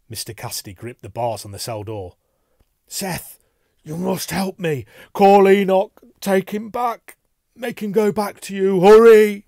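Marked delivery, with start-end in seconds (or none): 2.9-9.4 high, frantic plea building to a shout; panicked cry for help; desperate pleading. A narrator's reading. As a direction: beg urgently, louder as you go. Terrified man begging for help.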